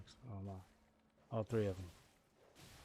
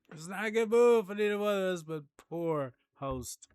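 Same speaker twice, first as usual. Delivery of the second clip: laughing; 10 semitones higher, 12 dB louder than usual. Real, not acted. Laughing repeat.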